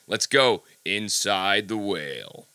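drawn-out, dramatic show announcement; slow dramatic. Acted. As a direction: stretch each word like a big reveal. Host's dramatic show sign-off line.

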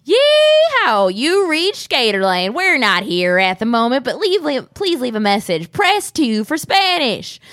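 yelled high yeehaw then hammy country drawl. Retold or imitated. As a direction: whoop it up like a wild gator-farm cowboy. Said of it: "this insane dude who was just like".

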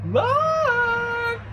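very high drawn-out screech. A narrator's reading. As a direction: screech the name out long. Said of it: "she screeched".

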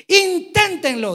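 Inténtenlo shouted high and slow, drawn out; a loud, slow Inténtenlo; exclaimed. Shown as a demonstration. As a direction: belt out Inténtenlo like an exclamation mark. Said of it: "Y está en signos de admiración".

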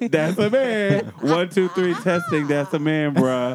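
loud mic-check parody; hammed-up announcer, loud; mock intercom announcement. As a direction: draw it out like testing a PA system. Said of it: "they on the motherfucking intercom".

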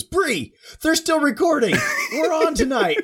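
high, squealing mock excitement with laughter creeping in; excited high-pitched retelling; perked-up excitement. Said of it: "She instantly perks up".

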